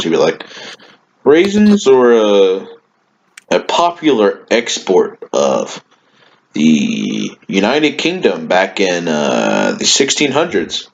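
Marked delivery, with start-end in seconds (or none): 1.2-10.9 teacher impression. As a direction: mimic a teacher searching for words, with long hesitations. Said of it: "he would pick at his fingers like he usually does".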